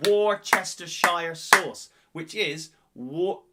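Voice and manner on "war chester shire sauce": slow, loud Worcestershire as "war-chester-shire"; emphatic WAR-CHESS-TER-SHIRE for Worcestershire; spelt-out pronunciation. Shown as a demonstration. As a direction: give Worcestershire every written syllable, slowly. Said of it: "[name]'s got it right".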